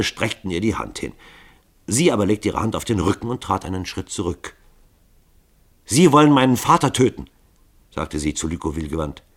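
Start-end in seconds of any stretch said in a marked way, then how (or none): 5.9-7.3 high, loud accusation; indignant daughter's outcry; accusing. A narrator's reading. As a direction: confront them in a raised voice. Daughter accuses her father's would-be killers.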